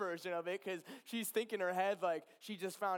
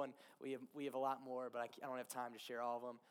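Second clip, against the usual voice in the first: usual speaking voice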